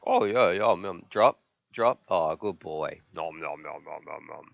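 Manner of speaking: low, soft doggy voice munching treats; lightly voiced dog savoring food; dog's happy voice. Streamer voicing his dog Charlie eating, low soft munching.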